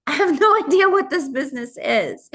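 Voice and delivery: mock cluelessness through a laugh; laughing self-doubt. New recruit quoting her own doubts about signing people up, laughing self-doubt.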